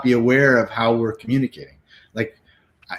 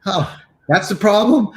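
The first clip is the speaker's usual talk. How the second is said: relieved surprise; 9 semitones higher, 3 dB louder than usual. Retold or imitated. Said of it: "So it's like".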